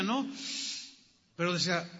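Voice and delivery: ordinary talk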